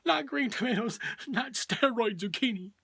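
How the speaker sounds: high-pitched cry of comic dread; mock horrified yell. Parents opening a bag of unwanted vegetables, mock horrified yell. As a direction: recoil in hammed-up horror. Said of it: "take one look and yell".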